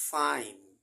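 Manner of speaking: ফাইন with an open-lipped ফ; loud demo word. Shown as a demonstration. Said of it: "এই ফাইনে ঠোঁট একসঙ্গে লাগানোর দরকার নাই".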